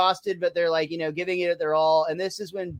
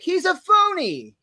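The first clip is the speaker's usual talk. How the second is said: much higher, slow, louder indignant cry; accusing runner; 13 semitones higher, 4 dB louder, slower than usual. Retold or imitated. Host voicing a runner exposing Bart, high, slow and loud. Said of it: "the other two runners are like".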